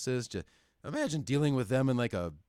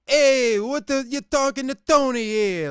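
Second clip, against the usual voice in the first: loud, high, over-the-top New York holler; 13 semitones higher, 10 dB louder than usual. Acted. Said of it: "Family Guy levels of stereotypical New York".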